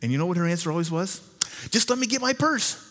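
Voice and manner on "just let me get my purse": imitating grandma, much higher and a bit louder; grandmother's voice. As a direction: chirp it like an eager old lady ready to go. Grandmother quoted in story.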